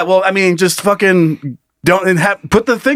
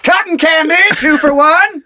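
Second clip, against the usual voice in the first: stadium vendor hawking; 12 semitones higher, slower than usual. Pretend. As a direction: sell cotton candy to the crowd.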